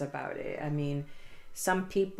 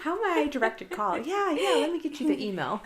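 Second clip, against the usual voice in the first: high-pitched phone operator, amused; mock receptionist voice; 8 semitones higher than usual. Co-host joins in answering a work call, mock receptionist voice. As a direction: play the helpful receptionist through a laugh. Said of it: "your professional hat on".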